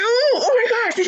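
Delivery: high, slow, drawn-out shocked cry of oh my gosh; shocked gasp. Retold or imitated. Acting out a shocked reaction.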